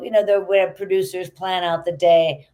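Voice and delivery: normal talking voice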